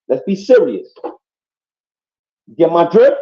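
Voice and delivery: ordinary talk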